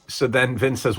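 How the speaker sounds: ordinary talk, nothing marked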